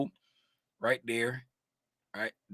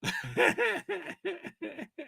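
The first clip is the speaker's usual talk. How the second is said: high soft laugh; high giggle; 9 dB quieter than usual. Real, not acted. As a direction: giggle at the chat. Laughing at viewer's spelling.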